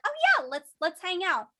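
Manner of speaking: high, bright mock-eager invite; friendly eagerness. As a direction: play a friendly colleague saying yes. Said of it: "they'd be like".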